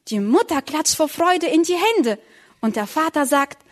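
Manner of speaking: usual speaking voice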